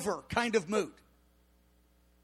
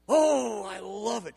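blissfully happy; slower than usual. Retold or imitated. Preacher re-enacting his joy to Diane, blissfully happy. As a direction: gush it with a long, contented "oh". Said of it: "so happy about".

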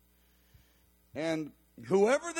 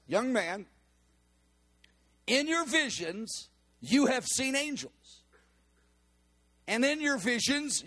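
higher, ringing prophetic declaration; prophesying woman; 6 semitones higher than usual. Retold or imitated. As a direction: deliver it as a bold word from the Lord.

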